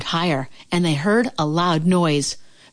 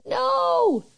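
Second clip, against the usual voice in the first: tiny peep. Acted. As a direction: shrink into a tiny voice. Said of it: "peeped Frog".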